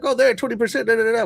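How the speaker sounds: high, sneering mock quote; mocking doom and gloomers. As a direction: scoff like a gloating doubter. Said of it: "are gonna look at this and be like".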